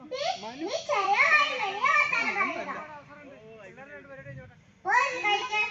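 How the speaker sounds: scolding mother. Acted. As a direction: snap at the cheeky daughter. Mother scolds back-talking daughter. (അമ്മയുടെ ദേഷ്യത്തോടെയുള്ള ശാസന)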